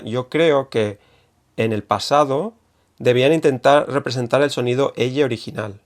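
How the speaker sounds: normal talking voice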